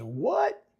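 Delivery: high, rising startled "What?"; surprised. Acted. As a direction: read it as a shocked reaction. Acted reaction to gossip in script.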